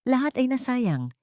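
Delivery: usual speaking voice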